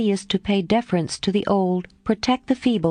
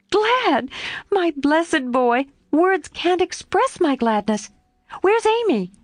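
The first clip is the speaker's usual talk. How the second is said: put-on much higher, delighted young woman's voice; overjoyed Jo; 9 semitones higher, slower than usual. A narrator's reading. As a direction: Jo gushing at her returned friend. Jo overjoyed at Laurie's return.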